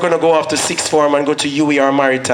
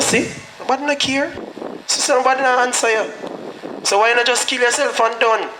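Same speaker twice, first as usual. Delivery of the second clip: higher, softer voice of the dark thought that taunts; taunting inner voice; 8 semitones higher, 4 dB quieter than usual. Retold or imitated. The thought mocks him in his head, higher and softer. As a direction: play the thought needling him to give up. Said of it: "the thought come back".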